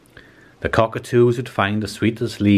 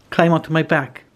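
much higher, squeaky call; a gentle giant with a tiny squeak; squeaky giraffe; 8 semitones higher than usual. A narrator's reading. Giraffe offering the animals his back, squeaky. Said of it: "he squeaked in his high voice".

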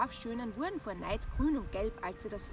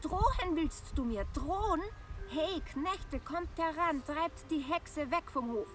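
angry bride; 6 semitones higher than usual. A narrator's reading. Bride calls servants.

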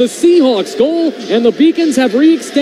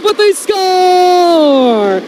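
long held high shout, very slow; long goal yell; 5 semitones higher, much slower than usual. Real, not acted. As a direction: stretch the goal call out in one breath. Goal scored in play-by-play.

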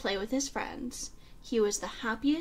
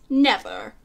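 loud refusal; 2 semitones higher, 8 dB louder than usual. A narrator's reading. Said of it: "he said".

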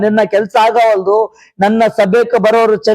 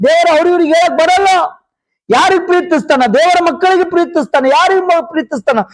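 high, driving question-and-answer sermon; fervent preaching; 8 semitones higher than usual. Real, not acted. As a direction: fire questions at the congregation and answer them.

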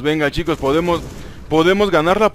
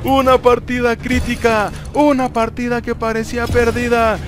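excited cheering; 7 semitones higher, slower than usual. Real, not acted. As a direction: celebrate the win at full voice. Winning the match, celebrating.

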